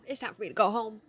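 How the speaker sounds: declared exit. Shown as a demonstration. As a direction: say it out like you mean it.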